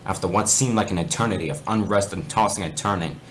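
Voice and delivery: a lightly dramatic reading of a spooky fanfic; story narration. Acted. Reading fanfic aloud to gaming friends, storyteller voice. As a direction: read it like a campfire tale, lightly.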